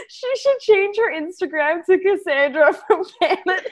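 high, laughing tease. Real, not acted. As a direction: giggle through the whole line. Teasing through laughter.

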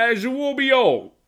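hammed-up British; British accent. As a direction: stretch "As will be all" in a posh-ish put-on British voice. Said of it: "if you say it in the accent".

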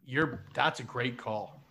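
usual speaking voice

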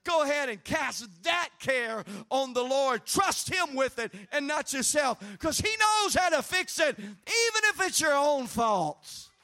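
loud building preacher's exhortation; preaching. Acted. Preacher urging the church to trust the Lord, loud. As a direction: urge the congregation with rising force.

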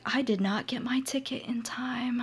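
hushed whisper. Retold or imitated. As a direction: whisper the bad news. Friend's sad news retold.

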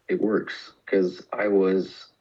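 normal talking voice

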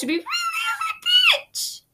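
squeaky high elf voice; christmas elf. Pretend. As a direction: go way up like a tiny elf. Mocking elf voice.

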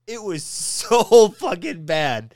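loud, slow, half-laughing disbelief; exasperated emphasis. Real, not acted. Exasperated verdict on an awful accent.

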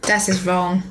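put-on failed French accent that comes out German; botched German-sounding accent. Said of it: "That's German".

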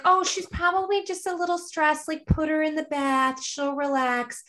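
high, airy brush-off that slows at the end; dismissive nurse voice. Retold or imitated. Nurse on phone dismissing husband, airy high brush-off. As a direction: wave away the panic lightly. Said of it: "they're like".

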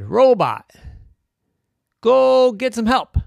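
loud, high, urgent order; frustrated child's shout; shouted command. Acted. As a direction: yell it up to a robot. Boy orders the robot to help.

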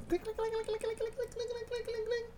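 high, rapid clicking noises; tiny high clicks in a quick run; imitated clicking. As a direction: rattle off high-pitched clicks. Imitates noise heard again.

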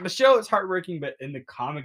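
normal talking voice

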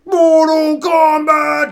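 high, slow, booming title yell; game announcer shout. Acted.

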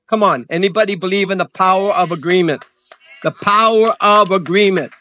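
rally the congregation with rising fervour; fired-up preaching. Real, not acted.

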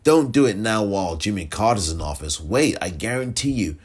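ordinary talk

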